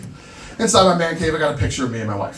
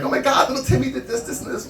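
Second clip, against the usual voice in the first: much higher, breathless school mom; gushing parent; 11 semitones higher than usual. Retold or imitated. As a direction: chirp it like an excited parent. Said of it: "they'll go up to my wife and be like".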